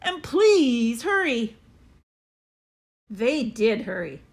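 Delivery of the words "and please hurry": high, slow, louder plea; boy urging. A narrator's reading. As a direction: press them to hurry. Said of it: "I yelled".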